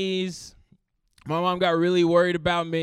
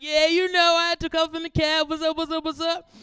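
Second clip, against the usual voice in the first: put-on much higher, nervous kid voice; awkward teen self; 11 semitones higher, 4 dB louder than usual. Younger self brushing off friends, high awkward voice. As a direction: play it cool but squeaky.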